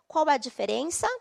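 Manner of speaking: normal talking voice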